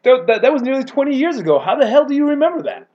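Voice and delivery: stunned that he recalls it; retold astonishment; disbelieving. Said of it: "I was like".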